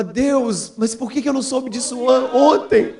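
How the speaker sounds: acted heartfelt questioning; imploring God. Acts out his cry to God.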